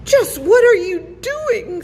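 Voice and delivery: high, loud panicked scream. A narrator's reading. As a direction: scream it in sudden terror. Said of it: "screamed Roland".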